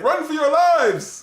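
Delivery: high, drawn-out mock cry; acted panicked warning. Batman telling everybody in the bar to leave, mock panicked cry. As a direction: call out a hammed-up alarm. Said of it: "He tells everybody in the bar to leave".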